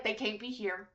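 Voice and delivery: soft, firm refusal; quiet firm refusal. Retold or imitated. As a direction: say it low and decided. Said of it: "I'd still be like".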